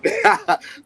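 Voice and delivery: sudden loud burst of laughter. Real, not acted. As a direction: crack up at your own silly act. Laughs at own impression.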